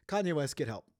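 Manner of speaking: ordinary delivery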